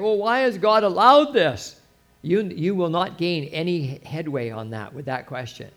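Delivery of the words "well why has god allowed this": complaining believer. Retold or imitated. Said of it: "it's not a matter of saying".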